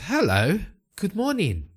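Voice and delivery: high, lilting hello; bright greeting. Acted. Hero calls a greeting to stranger.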